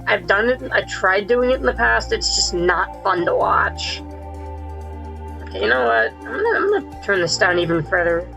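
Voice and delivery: usual speaking voice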